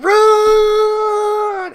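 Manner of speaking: loud, high panicked shout; shouting run. Acted. Fleeing boys shout to each other in panic. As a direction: scream it in full flight. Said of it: "And they're like".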